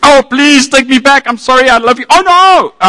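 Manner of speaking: high, hammed-up begging; mock distraught pleading. Melodramatic retelling of blurted love.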